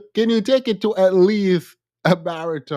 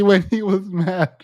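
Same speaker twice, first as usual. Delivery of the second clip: normal talking voice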